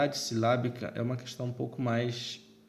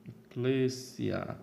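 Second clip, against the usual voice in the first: "eclésia" slowly, CLÉ drawn out and stressed; tônica na antepenúltima; much slower than usual. Shown as a demonstration. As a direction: stretch the stressed syllable: eCLÉÉsia. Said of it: "a tônica será a antepenúltima". ("eclésia" devagar, alongando o CLÉ)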